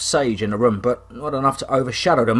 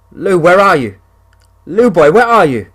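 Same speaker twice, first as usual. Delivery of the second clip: shouting out higher and louder; yelling for his dog; 7 semitones higher, 8 dB louder than usual. Acted. Hunter calling for his missing dog, loud yell. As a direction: call out across the woods for a lost dog. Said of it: "I yell".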